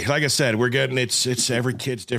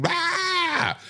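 high-pitched mock wail; imitated wailing. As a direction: wail like a crying kid on the phone. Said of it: "he's screaming and wailing and".

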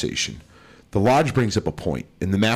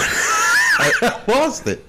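shouted through big laughter; laughing; much slower than usual. Real, not acted. Laughing at losing track.

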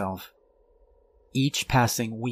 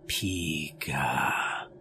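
low, drawn-out creature call; a hushed, low Pikachu-like Pika; Pikachu cry; 5 semitones lower, 3 dB quieter than usual. Pretend. Father becoming Pikachu, low creature call.

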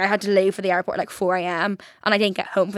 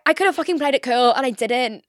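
normal talking voice

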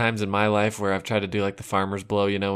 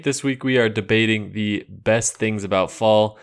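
radio host announcing. Acted. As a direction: announce the break and the comeback like a morning show host. Show host's segment break.

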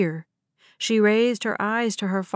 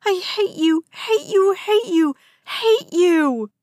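high, loud repeated screaming; girl screaming at her father while punching him; screaming hate; 9 semitones higher, 6 dB louder, slower than usual. A narrator's reading.

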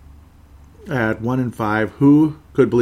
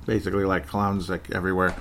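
ordinary talk, nothing marked

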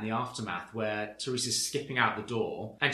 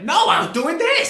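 much higher, much louder protest; mock indignant protest; 14 dB louder than usual. Mimicking Teresa demonstrating the grab, much higher and louder. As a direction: protest like a hammed-up indignant housewife.

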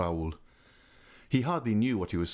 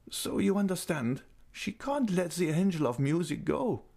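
put-on high, tender old lady's voice; fond old lady; 10 semitones higher than usual. A narrator's reading. Old lady invites Raoul near, voice high and fond. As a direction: coax him close like a loving old aunt.

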